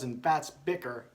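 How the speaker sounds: ordinary delivery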